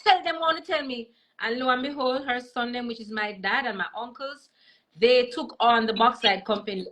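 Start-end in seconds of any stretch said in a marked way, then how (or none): 0.0-1.1 high, defiant Jamaican granny in patois; grandma's patois threat, pitched higher; feisty granny. Retold or imitated. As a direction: imitate a proud old grandmother standing her ground. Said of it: "And she said".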